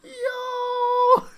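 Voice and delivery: sudden exclaiming yo; excited shout. Real, not acted. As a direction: burst out at what the company is doing. Exclaims at the screen.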